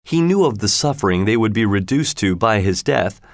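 ordinary delivery

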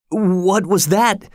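high, slow startled cry; frightened cry. A narrator's reading. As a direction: cry out in shock after the magic. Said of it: "cried Keola".

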